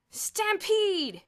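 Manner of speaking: high, loud yell. A narrator's reading. Bruno enjoying the chaos, yelling. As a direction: shout it for fun. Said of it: "Bruno yelled".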